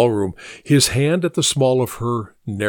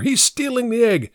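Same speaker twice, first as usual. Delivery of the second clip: higher alarmed call; shouted accusation; 10 semitones higher than usual. A narrator's reading. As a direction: raise the alarm at the thief. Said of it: "shouted Vladimir".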